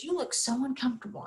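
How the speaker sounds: retold gentle remark, volume dropped; soft-voiced father. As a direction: say it softly, as a watchful father. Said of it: "his voice was so soft".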